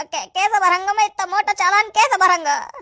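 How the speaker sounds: high, stammering plea; panicked young rider pleading against a big fine; desperate pleading. Acted. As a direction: beg in panic, voice pushed up high. Rider begging policeman over huge fine.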